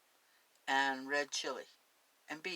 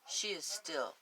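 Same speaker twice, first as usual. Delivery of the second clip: story narration; 5 dB louder than usual. Acted. Reads the story aloud.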